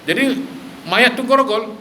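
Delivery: high-pitched indignant questioning; retold angry disbelief. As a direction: imitate someone appalled, voice jumping high. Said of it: "Nabi marah". (marah terkejut yang ditirukan)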